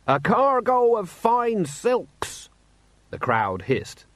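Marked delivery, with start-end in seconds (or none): 0.1-2.5 high, slow indignant shout; shouting merchant. A narrator's reading. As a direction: shout your grievance across the square. Jenkins answers heckler.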